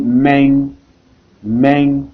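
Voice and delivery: "main" closed on a ringing ng, like "meng"; n final ajouté. Shown as a demonstration. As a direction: say "main" as "meng", nasal ending held. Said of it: "on met n pour dire main". (« main » prononcé « mèng », avec n final)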